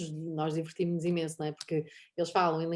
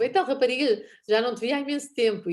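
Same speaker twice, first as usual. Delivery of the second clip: higher, louder jovial hail; lively quoted hello, pitched up; joking friend's greeting; 5 semitones higher, 6 dB louder than usual. Retold or imitated. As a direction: greet her like a long-lost friend. Imitating blind friend's joking greeting.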